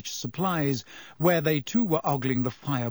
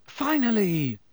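higher, relieved exclamation; exasperated greeting; 7 semitones higher, slower than usual. A narrator's reading. Ron greets Harry as he sits down, relieved. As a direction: let it out high, then fall away.

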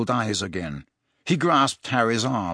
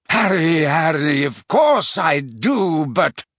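flustered protest; 4 semitones higher, 4 dB louder, slower than usual. A narrator's reading.

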